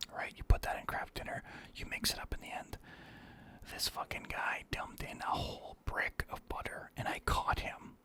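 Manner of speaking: ordinary delivery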